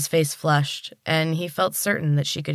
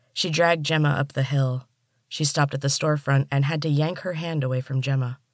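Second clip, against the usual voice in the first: ordinary delivery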